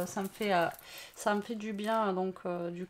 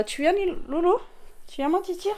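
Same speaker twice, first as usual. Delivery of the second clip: higher coaxing call to the cat; calling the pet; 8 semitones higher than usual. Real, not acted. Owner calling her cat Loulou, higher coaxing pet voice. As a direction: sweet-talk the cat into coming over.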